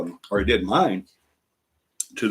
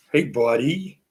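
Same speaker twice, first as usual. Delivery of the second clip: higher-pitched cooing greeting, rising at the end; 5 semitones higher, much slower than usual. Real, not acted. Grandparent greeting the new baby grandchild, talking to baby.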